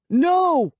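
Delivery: high, loud terrified scream. Acted. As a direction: shriek it in panic. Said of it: "I screamed".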